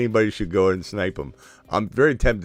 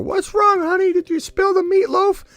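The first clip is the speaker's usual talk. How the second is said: high, loud, shrill-pitched mock mother calling down; hammed-up mom yelling from upstairs; 8 dB louder than usual. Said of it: "His mom's screaming from the top floor".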